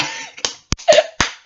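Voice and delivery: high breathless laugh; high airy giggle; real laugh. Real, not acted. Laughs at herself.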